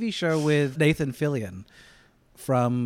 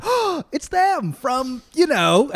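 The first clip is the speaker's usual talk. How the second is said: high, slow gasp of recognition; light up as if spotting a familiar face; excited recognition; 13 semitones higher, 5 dB louder, much slower than usual. Retold or imitated. Fan spotting a familiar actor.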